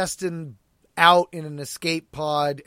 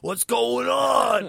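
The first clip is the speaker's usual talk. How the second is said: slow, loud, higher baffled shout; confused shout; 7 semitones higher, 5 dB louder, much slower than usual. Retold or imitated. Pan shouting when she gets promoted, baffled. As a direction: shout it out, totally thrown. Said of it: "Pan's character's like".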